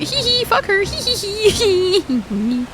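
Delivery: high, slow giggling taunt; mock giggle. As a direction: giggle it out like a little brat. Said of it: "I'm like".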